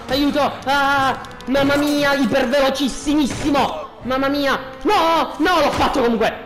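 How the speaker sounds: usual speaking voice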